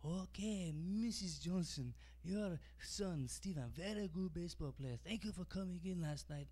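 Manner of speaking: the same takeout clerk now cheering at T-ball, put-on accent; hammed-up accent, slow on the name; mock Chinese accent. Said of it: "what do you think that guy is like".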